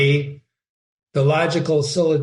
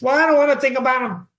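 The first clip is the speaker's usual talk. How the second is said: high, rapid mock objection; whiny protest; 10 semitones higher, faster than usual. Imagined student objects to thinking about propositions, mock protest. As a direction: blurt it out fast and high, like a balking student.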